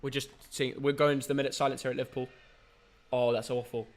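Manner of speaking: low, soft voice during a minute's silence; subdued, quiet murmur; hushed. Real, not acted. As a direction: keep it down out of respect.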